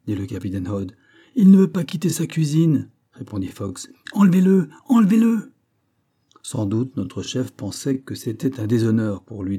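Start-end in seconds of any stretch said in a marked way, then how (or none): 1.3-2.9 high, loud report; raised-voice reply. A narrator's reading. Sergeant answering the captain mid-attack, raised voice. 4.1-5.5 high, loud, escalating command; shouted order. A narrator's reading. Ordering men to carry off the cook, urgent shout.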